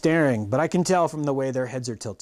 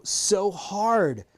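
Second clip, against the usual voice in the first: usual speaking voice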